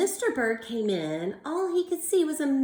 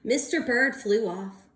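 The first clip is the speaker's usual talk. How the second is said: ordinary delivery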